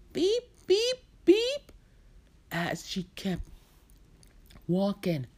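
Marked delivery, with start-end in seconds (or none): 0.1-1.7 high, loud electronic beeps; imitated beeping. Storyteller voicing the louder noise, high insistent beeping. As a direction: mimic an insistent alarm. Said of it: "the sound was getting louder".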